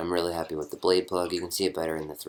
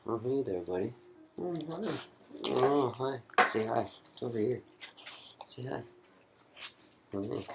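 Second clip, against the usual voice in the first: soft, high-pitched coo to a dog; talking to pet; 6 semitones higher, 6 dB quieter than usual. Real, not acted. Man greets his dog mid-video, soft pet-talk voice. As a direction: greet a pet softly in a raised voice.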